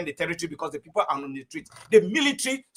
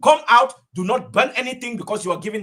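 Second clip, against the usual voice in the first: loud rallying cry; urgent rousing shout; calling out; 4 semitones higher, 6 dB louder than usual. Real, not acted. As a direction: call them out into the streets. Rallying call to people.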